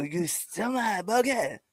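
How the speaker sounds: slow, high, laughing French voice; slow high mock French accent. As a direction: drag it out like an indignant Frenchman. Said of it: "You sound French as fuck".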